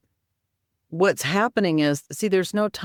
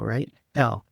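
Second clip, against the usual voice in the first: usual speaking voice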